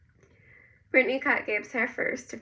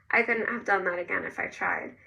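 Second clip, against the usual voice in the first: usual speaking voice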